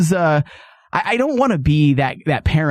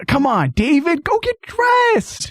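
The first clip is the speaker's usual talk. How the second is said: much higher and slow, drawn-out whine; mock plea; 12 semitones higher, much slower than usual. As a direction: beg him to go dress, pitched way up. Mock pleading with naked David.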